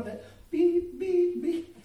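high mock truck beep, beep, beep. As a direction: squeak out the reversing beeps. Imitating truck backing up.

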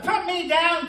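high, loud protesting demand; mock baby's cry. Baby wanting to be put down, mock protesting cry. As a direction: imitate a fussing baby in words.

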